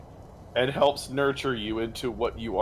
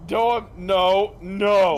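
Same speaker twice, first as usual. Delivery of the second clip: loud, high string of protests; insistent refusal; 5 semitones higher, 7 dB louder, much slower than usual. Acted. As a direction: shut them down loudly. Refusing to be called dad.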